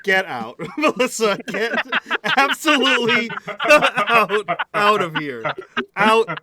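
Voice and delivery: loud laughing "get out"; mock outraged shout. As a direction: shout them out between laughs.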